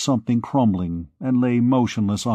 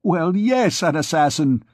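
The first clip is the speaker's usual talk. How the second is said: high, furious priest, raised voice; raging priest; 6 semitones higher than usual. Acted. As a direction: jeer at her like a fanatic priest.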